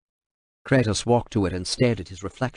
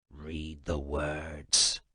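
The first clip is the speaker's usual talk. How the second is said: lower, much softer, very slow threat; a cold order pressed close; menacing command; 6 semitones lower, 11 dB quieter, much slower than usual. Acted. Kratos forcing the translator, low menace.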